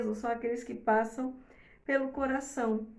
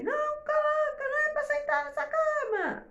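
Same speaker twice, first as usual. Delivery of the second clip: mock scolding shout. Parent scolding child off the bed, high harsh yell. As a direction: bark the order at the child. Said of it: "Você não tá falando assim".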